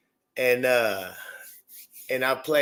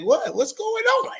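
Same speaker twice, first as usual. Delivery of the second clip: sudden high "what's going on"; surprised. Retold or imitated. Telling about the car ride, mock surprise. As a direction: replay the shock of hearing it. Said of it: "I, I was like".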